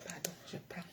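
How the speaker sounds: soft unvoiced whisper. Real, not acted. Whispering late at night on the live. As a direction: hush it so nobody wakes.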